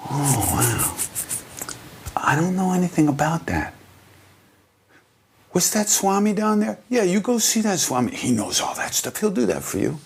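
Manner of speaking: high, innocent playing-dumb guru; high sing-song guru feigning innocence; playful guru voice. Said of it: "And Maharaji just goes".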